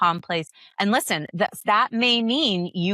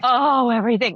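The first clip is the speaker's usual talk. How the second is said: mock self-blame; 6 semitones higher, 6 dB louder than usual. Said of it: "I'm not like".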